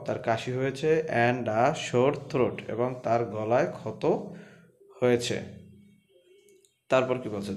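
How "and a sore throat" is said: words stretched out slowly; slow dictation of a sore throat; very slow reading. Shown as a demonstration. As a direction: drag it out word by word. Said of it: "teacher reads sentence slowly".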